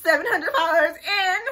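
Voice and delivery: high, loud mimicry of a complaint; laughing mock whine. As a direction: whine it mockingly through laughter. Mimicking her old whining clip.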